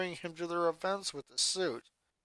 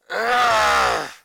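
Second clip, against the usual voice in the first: loud frustrated growl; loud exasperated urgh; annoyed growl; 4 semitones higher, 11 dB louder than usual. A narrator's reading. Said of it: "Luna growled in annoyance".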